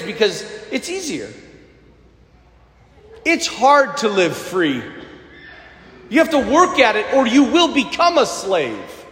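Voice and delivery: ordinary delivery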